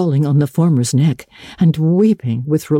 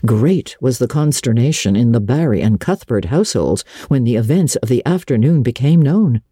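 plain reading voice

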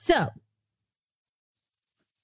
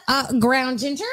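ordinary delivery

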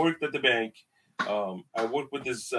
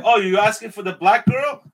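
raised, louder pointed reply; loud retort; 7 semitones higher, 11 dB louder than usual. Retold or imitated. To rude customers asking for her coworker, loud pointed retort.

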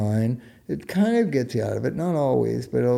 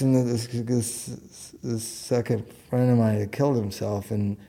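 ordinary talk, nothing marked

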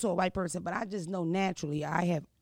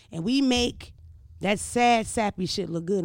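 normal talking voice

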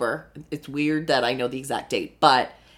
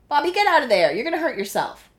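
higher, coaxing pet talk; 10 semitones higher, faster than usual. Real, not acted. Owner coaxing her cat out of the dishes. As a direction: coax the cat out gently.

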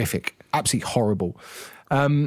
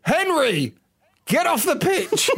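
high, plummy mock call; posh voice; 13 semitones higher, slower than usual. Mocking the posh teammate's side, high plummy voice. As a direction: call out like a fussy posh footballer.

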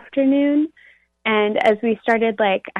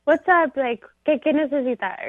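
put-on New Rican accent; 6 semitones higher than usual. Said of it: "talking to me in a New Rican accent".

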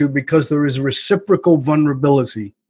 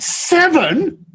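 high outraged disbelief, voice shooting up; mad; 11 semitones higher, slower than usual. Retold or imitated. To his wife over her score, high indignant outrage. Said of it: "I'm mad".

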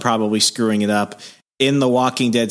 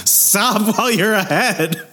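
laughing through a slow, higher warning; 8 semitones higher, slower than usual. Real, not acted. Co-host cuts the joke short, laughing. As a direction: stop him mid-laugh, drawn out.

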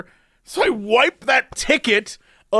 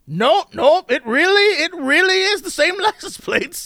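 higher, hammed-up old attendant checking plates; fussy old man; 10 semitones higher than usual. Old parking attendant puzzling over the driver's plates, higher and hammed-up. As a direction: play a dithering old man muttering nope.

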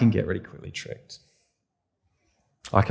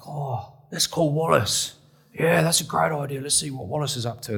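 tipsy mates; 5 semitones higher than usual. Retold or imitated. Old mates phoning the pastor late at night, tipsy and merry. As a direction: ham up a cheery tipsy mate. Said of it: "They were well-oiled".

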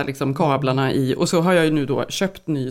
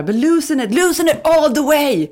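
high, loud imitated command in English; hammed-up urgent command; mock hissed order; 9 semitones higher, 5 dB louder than usual. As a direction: bark it like the husband hissing instructions. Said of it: "och så väser han till mig".

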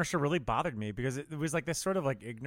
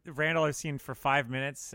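normal talking voice

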